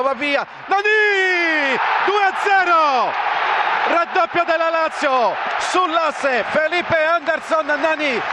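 slow drawn-out shouted goal call; excited goal call. Real, not acted. Second goal called live.

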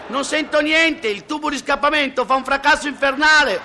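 high, sustained yell; comic straining shout on one high note; acted shout. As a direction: holler it over a roaring exhaust. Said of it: "urla lei".

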